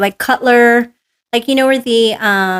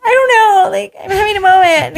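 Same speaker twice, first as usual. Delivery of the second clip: high, loud mock wail; hammed-up flustered cry for help; helpless whining; 11 semitones higher, 3 dB louder, faster than usual. Woman caught out by her trainer, helplessly whining.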